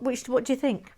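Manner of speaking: usual speaking voice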